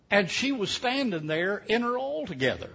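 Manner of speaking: ordinary delivery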